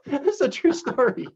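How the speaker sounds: high, laughing through the words; real laughter. Real, not acted. Joker swearing his baseball punchline really happened, laughing. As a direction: insist on it while cracking up.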